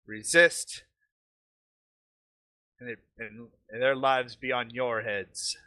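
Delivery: put-on lightly accented villain issuing a warning. Villain warns the party to leave or bloodshed follows, lightly accented. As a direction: give a commanding warning in a mild foreign accent. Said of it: "He speaks with an accent".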